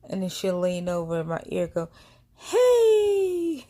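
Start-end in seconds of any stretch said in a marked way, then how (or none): 2.4-3.6 piercingly high, loud, drawn-out squeal; high-pitched baby greeting. Retold or imitated. Baby leaning into sleeping woman's ear, high-pitched squeal. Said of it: "really high pitch like that".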